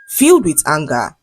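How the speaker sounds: ordinary delivery, nothing marked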